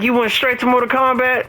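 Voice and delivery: high, accusing imitation of the girlfriend; mock girlfriend voice. Boyfriend mimics girlfriend scolding him for gaming, high mock voice.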